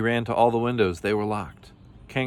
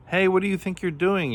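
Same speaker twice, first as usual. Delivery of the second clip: very high, indignant storybook yell; 11 semitones higher, 3 dB louder than usual. A narrator's reading. Camel stranded on the roof, yelled. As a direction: shoot the pitch up in protest. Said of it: "yelled Camel".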